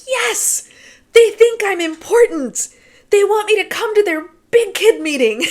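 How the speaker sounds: high, loud gleeful squeal of triumph; thrilled to be let into the big kids' meeting; very excited. Retold or imitated. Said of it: "very excited about this".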